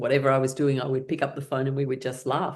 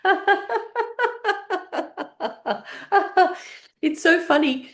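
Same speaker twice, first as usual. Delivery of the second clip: pretend phone laughter. Friend on the phone tells a joke, hearty high laughter. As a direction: laugh hard at what your friend just said. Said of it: "start laughing".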